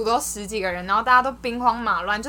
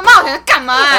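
louder, higher scolding yell; angry yell; 3 semitones higher, 12 dB louder than usual. Retold or imitated. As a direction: snap at the lost kid, full volume. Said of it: "然后凶到爆". (凶到爆地大声骂)

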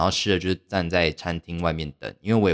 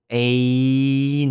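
surprised teacher; 7 semitones higher, 5 dB louder than usual. Retold or imitated. Teacher greets her in surprise.

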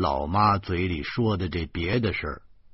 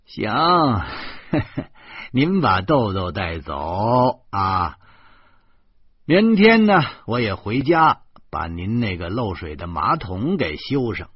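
loud, higher teasing offer to his mother; mock indifference; 5 semitones higher, 5 dB louder than usual. A narrator's reading. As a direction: fling it out like he couldn't care less. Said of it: "我无所谓地说". (满不在乎地大声逗老妈)

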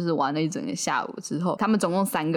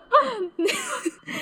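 high laugh. Real, not acted. Reacting to the mass murder remark.

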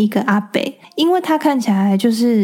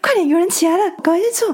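high, urgent granny voice; mimicking a granny; 8 semitones higher, faster than usual. A granny hurrying her friend to grab a seat, high-pitched.

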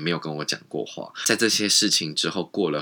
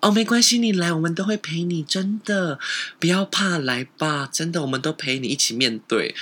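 high, sugary coaxing voice; mock-sweet, luring a friend in; fake sweet reassurance; 10 semitones higher than usual. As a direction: saccharine fake comfort, pitched high. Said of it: "就说".

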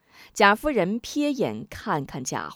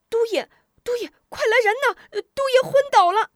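high, fast, terrified shouting; panicked cry for help; 6 dB louder, faster than usual. A narrator's reading. Soldier sees his lord faint and yells for help, panicked. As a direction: scream for help as your master collapses. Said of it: "立刻惊恐呼叫".

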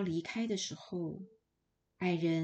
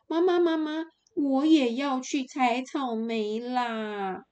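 higher, louder, slow drawn-out begging; pleading whine; 5 semitones higher, 6 dB louder than usual. Acted. Spoiled daughter begs her mother to go, whining. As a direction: whine at mom like a spoiled child. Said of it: "于是她哀求妈妈说".

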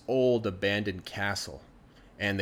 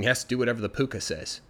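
usual speaking voice

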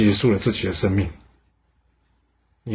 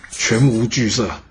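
ordinary delivery

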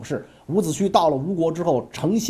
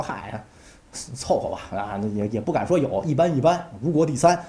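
low, soft, falsely humble shrug; mock modest; 5 semitones lower, 4 dB quieter than usual. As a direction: play it down with fake modesty. Sun Wu modestly downplaying. (孙武对姬光故作谦虚，低声)

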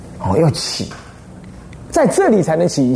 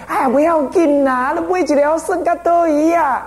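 much higher, slow, wheedling shopkeeper call; pleading shopkeeper; 11 semitones higher, slower than usual. Retold or imitated. Shopkeeper calling after the mother to buy one toy, high and wheedling. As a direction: coax sweetly, drawing the words out high. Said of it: "他还继续喊哦，他就讲".

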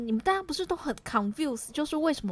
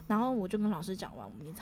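ordinary delivery

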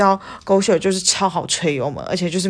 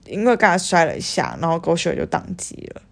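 usual speaking voice

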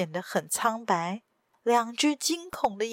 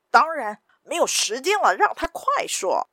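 louder, high-pitched henchman urging haste; impatient henchman; 5 semitones higher, 4 dB louder than usual. A narrator's reading. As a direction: press the gang to hurry, voice jumping up. Impatient henchman demands quick confession. (不耐烦的高声催促)

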